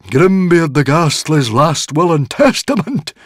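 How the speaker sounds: high, loud, slow amazement; astonished gasp. A narrator's reading. Druid Guardian hears of the will, gasping in amazement. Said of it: "gasped the Druid Guardian".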